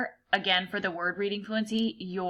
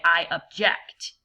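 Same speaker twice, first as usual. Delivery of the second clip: slow, louder I object with JECT stressed; stress on second syllable; 6 dB louder, slower than usual. Acted. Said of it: "like a judge would say".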